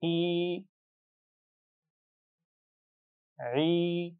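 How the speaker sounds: की, ही, ई, ई each held long, the two ई kept distinct; long ee sounds. Shown as a demonstration. Teacher models long ee for the class: held and distinct. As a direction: stretch every ee, separate hamza from ain. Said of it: "एक दफ़ा फिर इन्हीं दोनों मिसालों को हम पढ़ेंगे".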